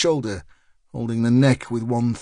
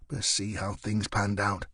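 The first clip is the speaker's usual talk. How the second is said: plain reading voice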